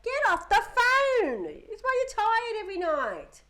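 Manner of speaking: loud, high, slow scolding; dad yelling from the bedroom door; angry dad shout. Retold or imitated. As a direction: bellow at the teen to get off the phone. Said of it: "he's like".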